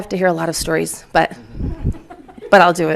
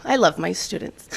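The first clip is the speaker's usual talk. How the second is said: ordinary talk, nothing marked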